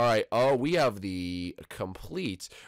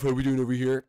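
Ralph voice; 4 dB louder, faster than usual. Acted. Ralph answers in his voice.

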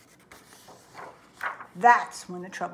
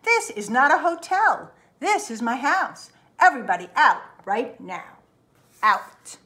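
loud, higher angry outburst; furious bear; 3 semitones higher, 5 dB louder than usual. Acted. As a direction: throw them all out. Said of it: "Finally, Bruce lost his temper".

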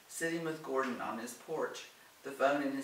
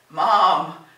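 loud, higher cry of "Mom!"; exclaimed protest; 5 semitones higher, 17 dB louder than usual. A narrator's reading. Grown children object at once to their mother meddling, loud exclamation. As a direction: call out sharply in protest. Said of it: "Gordon and Alice said at once".

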